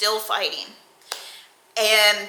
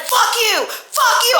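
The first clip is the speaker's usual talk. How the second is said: much higher, louder furious shout; retold angry shout; 14 semitones higher, 4 dB louder than usual. Cursing girlfriend in anger, high loud shout. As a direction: hurl it at her, high. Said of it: "And I was like".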